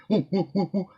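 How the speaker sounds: high hooting monkey calls; playful mock monkey howl. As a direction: hoot like a howler monkey. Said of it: "howling monkeys".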